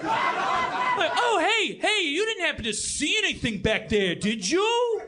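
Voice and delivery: put-on high, hammy Jersey accent; mock New Jersey killer. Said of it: "all serial killers are from New Jersey".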